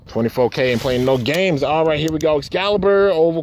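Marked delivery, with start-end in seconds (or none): none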